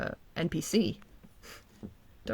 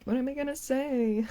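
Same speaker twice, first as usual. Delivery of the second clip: higher, quizzical mock voice; mock dopey guy voice; 7 semitones higher than usual. Corrected take of the thumbnail guy, mock dopey voice. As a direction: play him lost for words. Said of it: "it's more like".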